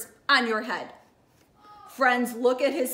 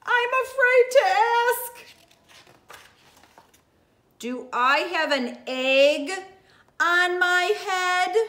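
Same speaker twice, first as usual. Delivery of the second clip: Elephant, nervous; 3 semitones higher, 5 dB louder than usual. Acted. Elephant voice in read-aloud.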